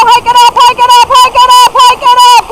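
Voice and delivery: high squeaky hike calls; squeaky praising a dog. Real, not acted. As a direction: squeak it up to urge them. Urging dogs through water.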